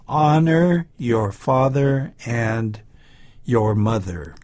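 slow, clear model sentence for repeating; slow model reading. Shown as a demonstration. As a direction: say it slowly for the class to copy. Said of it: "Let's say this".

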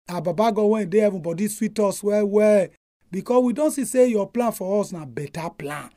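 softly spoken prayer. Real, not acted. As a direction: pray it earnestly and gently. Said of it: "May we pray".

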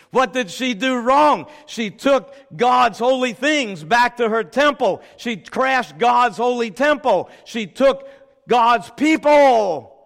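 loud, building to a slow heavy close; fiery preaching. Acted. Preacher condemning Babylon to the congregation, fiery preaching. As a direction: hammer each charge home.

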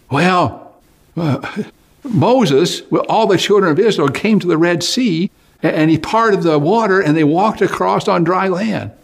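high, bubbling explanation; eager explaining. Acted. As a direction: tell it with breathless delight. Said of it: "young man explains".

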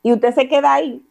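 ordinary delivery

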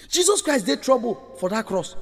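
ordinary talk, nothing marked

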